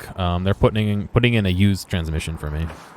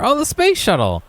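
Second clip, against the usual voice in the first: excited; 13 semitones higher than usual. Real, not acted. Spots the shuttle in game.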